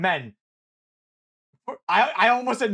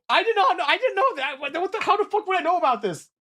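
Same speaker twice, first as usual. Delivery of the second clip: exasperated protest; 9 semitones higher than usual. Real, not acted.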